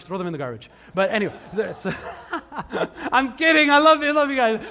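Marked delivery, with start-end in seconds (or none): 2.0-3.1 high breathy chuckle; breathy laugh. Real, not acted. Preacher cracks up at his own joke, breathy laugh. 3.1-4.6 loud, high, laughing backpedal; laughing reassurance. Real, not acted. Preacher teases the congregation then takes it back, loud and laughing.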